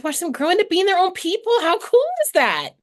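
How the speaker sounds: high, loud delighted exclaim; excited. Real, not acted. Mother tells a podcast host about her kids, high and thrilled. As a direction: burst out with pride.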